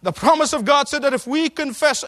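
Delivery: full-force pulpit preaching; fiery preaching. Acted. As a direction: rouse the congregation.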